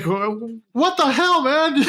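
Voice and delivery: high, exasperated squawk of disbelief; playfully scolding a movie character, hammed-up high outrage; mock outrage. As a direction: shriek it at the screen, half laughing. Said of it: "Like, like".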